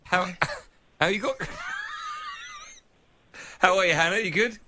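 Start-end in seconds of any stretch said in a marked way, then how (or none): 1.4-2.6 high, helpless shriek of laughter; cracking up completely. Real, not acted. Laughing at prank caller.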